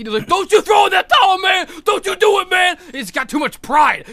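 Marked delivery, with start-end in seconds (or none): 0.3-2.8 loud, high, forceful acted yell; Apollo shouting. Apollo orders Rocky not to stop the fight, shouting. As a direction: imitate a proud fighter shouting a warning. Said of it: "Apollo looks at Rocky".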